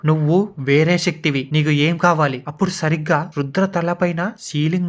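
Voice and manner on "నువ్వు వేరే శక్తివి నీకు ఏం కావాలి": plain reading voice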